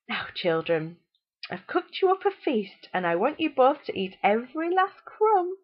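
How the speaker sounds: high, sing-song jolly voice; bright and cheery, overly sweet. A narrator's reading. Mother jolly as she serves the children a feast. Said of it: "she said in a jolly manner".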